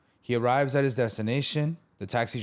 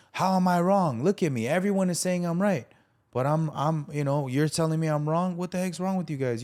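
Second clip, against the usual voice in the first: a man telling the church people off, voice raised high; blowing up; 7 semitones higher than usual. Retold or imitated. The burned man blasting the pastor, in a heated retold rant. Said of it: "He starts blowing up".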